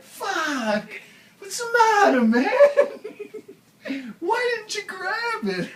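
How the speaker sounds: high, loud exasperated yelling, half laughing; exasperated shout. Retold or imitated. Jerry after losing the fish, yelling exasperated at John. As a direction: yell at him for letting the fish go. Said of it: "Jerry was just like".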